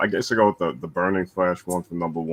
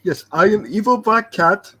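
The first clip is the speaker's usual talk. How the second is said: high, loud, slow impression; 14 semitones higher, 8 dB louder than usual. Jokingly posing as the absent panelist, high loud impression. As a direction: imitate a fellow panelist, high and drawn out. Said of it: "my impression".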